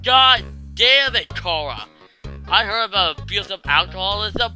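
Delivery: normal talking voice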